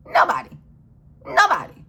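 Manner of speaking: sharp, very high yelled "Nobody"; shouted nobody. Real, not acted. Ranting vlogger shouts "Nobody" at the system. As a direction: fling "Nobody" up in a cry. Shouting answer to her question.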